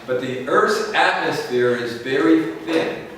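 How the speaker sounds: usual speaking voice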